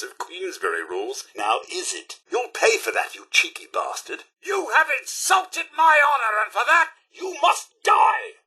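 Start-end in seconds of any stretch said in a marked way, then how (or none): 4.4-8.4 high, indignant shout; theatrical cry of wounded honour; outraged battle cry. Acted. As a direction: declare a duel in fury. Robot declares fight to avenge honour.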